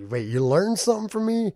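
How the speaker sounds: surprised. Retold or imitated. Imitating listener surprised at learning.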